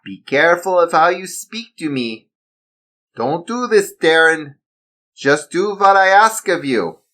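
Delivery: high, threatening German villain; German accent. Acted. Villain warning Darren, German-accented threat. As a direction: warn him in German accent. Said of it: "He spoke in a German accent".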